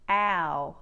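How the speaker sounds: ow started from the a of cat; broad Australian ow. Shown as a demonstration. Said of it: "they start it with cat instead".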